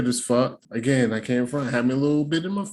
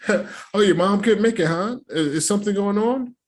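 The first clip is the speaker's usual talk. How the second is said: high-pitched nosy chirp; imitating a tactless questioner, pitched up; mock prying questions; 7 semitones higher than usual. Acting her prying shoot questions.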